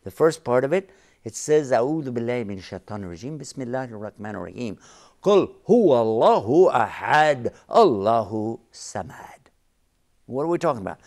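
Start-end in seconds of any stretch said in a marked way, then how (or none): 1.7-4.8 rapid spoken Arabic invocation; quick recitation. Real, not acted. Preacher citing a surah to the host, quick spoken recitation. Rattles off formula before verse. 5.2-9.5 loud, measured Arabic verse recitation; emphatic recitation. Real, not acted. Preacher quoting the surah to the host, emphatic recitation.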